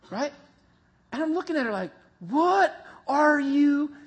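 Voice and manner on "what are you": high, slow, stunned what-are-you-thinking; drawn out in horrified disbelief; alarmed disbelief. Retold or imitated. Husband staring at his wife unhooking the leash, aghast.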